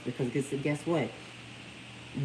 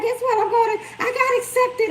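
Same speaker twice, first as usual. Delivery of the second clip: much higher, squealing with news; imitating excited daughter; 6 dB louder than usual. Mom voicing the daughter announcing her acceptance, high and excited. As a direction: burst out with the good news, way up high. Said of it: "She was like".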